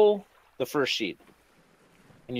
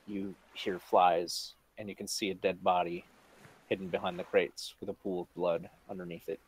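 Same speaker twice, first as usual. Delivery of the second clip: ominous narration; 4 semitones lower, 7 dB quieter than usual. A narrator's reading. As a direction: draw them in with dread. GM sets grim scene.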